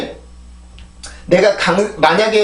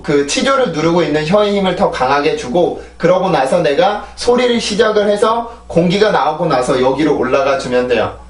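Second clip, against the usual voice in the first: normal talking voice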